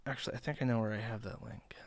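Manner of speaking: quick unvoiced whisper to herself; hushed, rushed aside. Real, not acted. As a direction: whisper it fast while searching. Muttering to self while searching.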